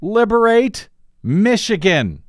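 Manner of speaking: sarcastic shouted tweet; all-caps shout. Retold or imitated. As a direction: bark it like a shouted slogan. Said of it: "Again, all caps".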